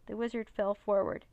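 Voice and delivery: ordinary delivery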